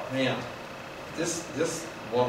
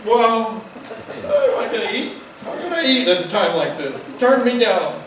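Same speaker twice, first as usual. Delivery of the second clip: whining king; 7 semitones higher, 4 dB louder than usual. Retold or imitated. Ahab whines his complaint.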